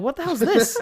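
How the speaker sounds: a baffled scoff; mock disbelief. Telling off a street scammer, mock disbelief. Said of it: "And I'm like".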